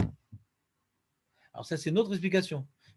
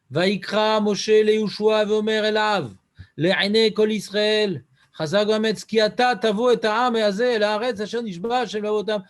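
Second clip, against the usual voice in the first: scripture reading; 6 dB louder than usual. Acted. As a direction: declaim the verse to the class. Rabbi chants Hebrew verse aloud. (lecture solennelle du verset)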